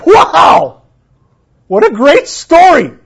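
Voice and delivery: loud, high delighted exclamation; excited wow. Real, not acted. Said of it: "childlike excitement about story".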